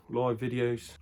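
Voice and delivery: usual speaking voice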